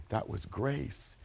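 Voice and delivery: soft, slow statement; a quiet, slow close; hushed and slow. Acted. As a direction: let the word grace settle. Hushed conclusion.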